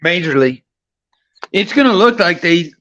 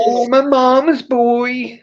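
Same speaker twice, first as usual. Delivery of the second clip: high, slow mocking coo; teasing; 8 semitones higher, slower than usual. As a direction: drag it out to tease a friend. Teasing friend about being mama's boy.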